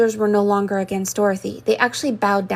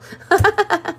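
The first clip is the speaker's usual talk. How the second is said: a quick amused high-pitched laugh; bemused chuckle at the odd numbering; short high laugh; 10 semitones higher than usual. Real, not acted.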